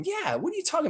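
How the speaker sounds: high, fast, scoffing disbelief; scoffing at a viewer's comment, high and fast; incredulous scoff. Real, not acted. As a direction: brush the claim off in mock disbelief. Scoffing at a chat claim.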